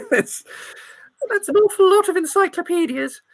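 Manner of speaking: put-on high, amused old lady; granny eyeing the samples. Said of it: "my old granny voice".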